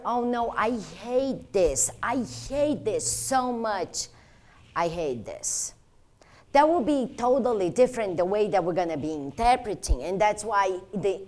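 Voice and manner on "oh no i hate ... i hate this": demonstrated dismay. Shown as a demonstration. Trainer demonstrating a patient's inflection, dismayed and drawn out. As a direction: stretch out the hate, then sink at the end. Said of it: "There's a huge difference when you say".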